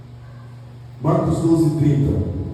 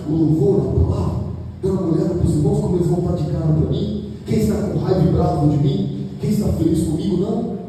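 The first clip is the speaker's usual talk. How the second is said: higher, fast fervent sermon voice; impassioned preaching; 4 semitones higher than usual. Acted. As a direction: drive the point home to the congregation. Preacher at full force. (pregação inflamada e rápida)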